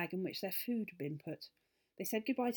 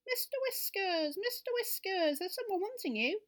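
rabbit calling down; 13 semitones higher, 4 dB louder than usual. Acted. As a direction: call down the hole as the rabbit. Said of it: "he called down his hole".

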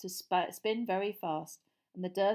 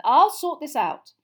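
high, loud, slow assurance; shouted; 7 semitones higher, 14 dB louder, slower than usual. A narrator's reading. Mister Weasley sending Harry away from the fight, shouting. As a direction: shout it firmly over the commotion. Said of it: "Mister Weasley shouted".

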